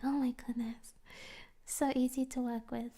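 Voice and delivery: soft unvoiced whisper; hushed relief; grateful whisper. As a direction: whisper the thanks like a secret. Whispered gratitude about chill client.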